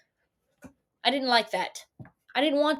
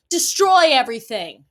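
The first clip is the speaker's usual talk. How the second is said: mock dramatic declaration; 8 dB louder than usual. Mock self-destructive decision.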